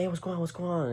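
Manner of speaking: high-pitched interjecting voice; imitated friend. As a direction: mimic a friend stepping in, pitched well up. Acting out the friend stepping in.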